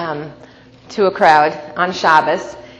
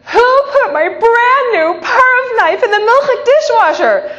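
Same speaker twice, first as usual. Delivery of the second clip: much higher, louder, slow wailing cry; upset outcry; 7 dB louder, slower than usual. Retold or imitated. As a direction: wail it like a ruined morning. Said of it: "So I said".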